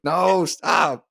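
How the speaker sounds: high, pleading mock shout; mock exasperated shout. Listener hears the song on radio, mock exasperated shout. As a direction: cry out as if the song is back. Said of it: "You're like".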